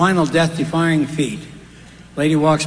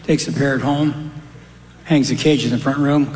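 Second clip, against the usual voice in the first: ordinary talk, nothing marked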